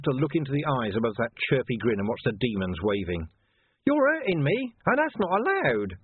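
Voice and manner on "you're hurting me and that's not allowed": high, mock-whiny complaint; whiny villain. A narrator's reading. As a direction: whine like a spoilt child. Villain whining.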